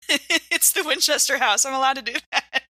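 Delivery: normal talking voice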